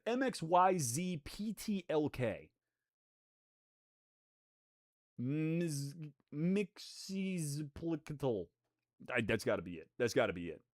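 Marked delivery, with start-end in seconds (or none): 0.1-8.5 Mixizplicatal spelled and sounded out slowly, letter by letter; sounding it out. Shown as a demonstration. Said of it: "how to pronounce that".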